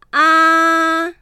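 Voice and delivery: teacher demonstrating the vowel あ with mouth wide open; Mandarin vowel a. Shown as a demonstration. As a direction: open the mouth tall and hold あ. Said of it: "口を縦に大きく開けたままで、喉の奥から発音する".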